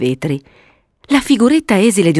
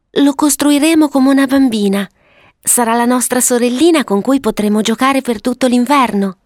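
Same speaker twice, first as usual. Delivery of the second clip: put-on higher, girlish storybook voice; little girl Violetta; 6 semitones higher than usual. Violetta planning a snow sister with her brother, high child voice.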